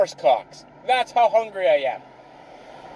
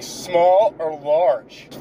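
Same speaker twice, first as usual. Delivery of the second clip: slow mock clerk voice; much slower than usual.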